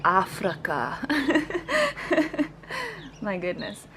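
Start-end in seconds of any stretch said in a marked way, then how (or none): 0.0-1.0 "Africa" mimicked with "uh" in the middle, "Af-ruh-ka"; loudly mock the schwa in "Africa"; misplaced schwa. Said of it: "putting a schwa where it shouldn't be". 1.0-3.2 high, helpless amused laughter. Real, not acted. Laughs at the mispronunciation.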